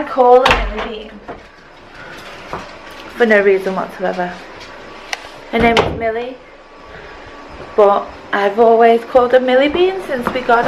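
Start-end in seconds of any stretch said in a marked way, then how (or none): none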